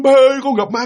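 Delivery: high pleading cry; acted kid's scared report; frightened child. Child telling father of seeing a ghost, frightened.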